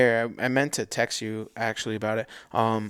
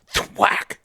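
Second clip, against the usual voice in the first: imitated arrow thwack; 7 semitones higher than usual. Imitating arrow hitting moose.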